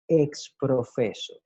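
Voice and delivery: exprofeso said slowly and clearly, ex-pro-FE-so; careful Latin term. Shown as a demonstration. Teacher introduces the Latin phrase clearly. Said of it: "la expresión en latín".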